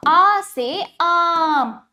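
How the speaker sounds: "आ से आम" with "आ" drawn very long, mouth wide open. Shown as a demonstration. Teacher showing her open mouth for "आ से आम". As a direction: stretch the "आ" in "आम" slowly. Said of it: "मेरा मुख पूरा खुला।".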